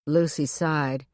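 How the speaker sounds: ordinary delivery, nothing marked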